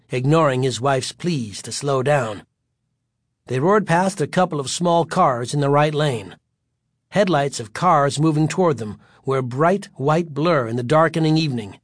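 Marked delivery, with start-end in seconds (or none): none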